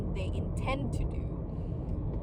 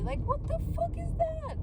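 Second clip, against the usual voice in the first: exasperated outburst. Real, not acted. Venting to the camera about climate pledges, exasperated high cry. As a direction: pitch jumps way up in disbelief.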